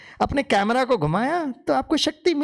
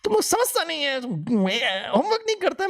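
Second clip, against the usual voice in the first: higher, exasperated scolding of students; a teacher losing patience with pupils; irritated teacher; 5 semitones higher than usual. Retold or imitated. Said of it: "मैं भड़क गया". (चिढ़ा हुआ शिक्षक)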